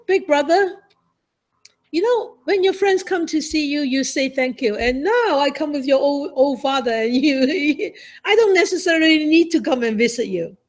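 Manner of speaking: high and loud, laughing and near tears; pleading scold. Retold or imitated. Sister scolding her brother from his bedside, high and tearful. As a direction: plead with him, voice rising.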